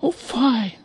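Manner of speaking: high, scandalized mother duck; mother duck appalled; high shocked duck exclamation; exclaiming mother duck. Acted. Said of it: "the mother duck exclaimed".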